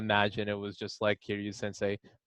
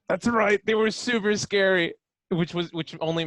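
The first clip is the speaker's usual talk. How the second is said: higher and louder, eager; excited agreement; 9 semitones higher, 7 dB louder than usual. Real, not acted. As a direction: jump in high and loud with glee. Eager agreement about scary spiders.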